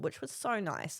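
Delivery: ordinary delivery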